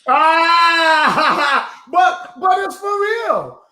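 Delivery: laughing shout at the joke. Real, not acted. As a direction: laugh it out in disbelief. Host laughs at guest's joke.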